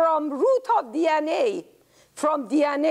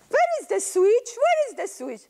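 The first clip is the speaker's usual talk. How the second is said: confused; 10 semitones higher than usual. Acted. Grandma searching.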